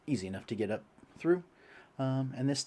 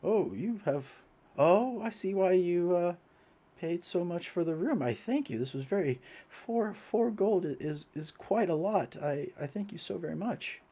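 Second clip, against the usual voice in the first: lightly voiced grateful old priest; slightly higher, humble old man; 8 semitones higher than usual.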